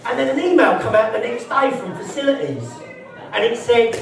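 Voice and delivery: usual speaking voice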